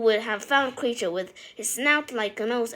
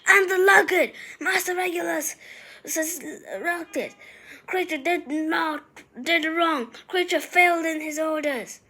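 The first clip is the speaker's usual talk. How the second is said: much higher, loud despairing shriek; anguished scream; 6 semitones higher, 3 dB louder than usual. A narrator's reading. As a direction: wail out his failure in torment. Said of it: "he uttered a blood-curdling scream".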